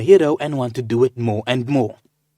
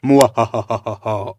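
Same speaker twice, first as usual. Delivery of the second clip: slow, loud hammed-up villain cackle; evil laugh; 5 dB louder, slower than usual. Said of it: "I am evil because I am laughing like this".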